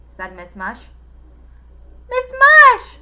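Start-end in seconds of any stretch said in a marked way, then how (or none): 2.1-3.0 high, very loud shout. A narrator's reading. Girl shouting for help to the cook, very loud. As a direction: scream her name for help. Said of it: "shouted Allison".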